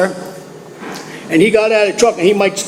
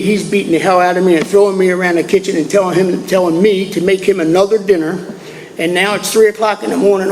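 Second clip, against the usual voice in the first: usual speaking voice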